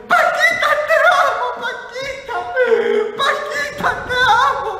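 very high, loud mock squeal; hammed-up falsetto swooning; adoring male fan. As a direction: shriek your love like a crazed fan. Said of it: "Y el hombre".

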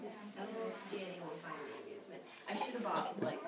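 ordinary talk, nothing marked

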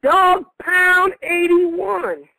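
slow, drawn-out pick reveal; draft announcer. Acted. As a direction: announce the name big and slow.

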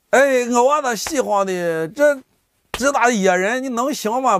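caring, fretting mother. Acted. Mother meeting her long-absent son, high fretful concern. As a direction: fuss tenderly over your child, pitched well up. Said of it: "意思是充满了关心、".

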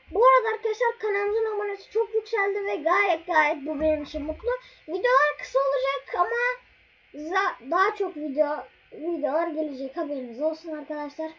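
excited. Real, not acted. Excited channel announcement.

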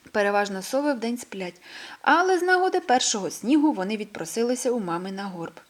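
ordinary delivery, nothing marked

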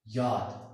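German J said as "याद", with a y sound; German letter name. Shown as a demonstration. Teacher naming the letter J for learners, German pronunciation "याद". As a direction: say "याद" starting with y, not English j. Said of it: "इसका तलफ़ुज़ है German language में".

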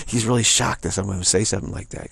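ordinary delivery

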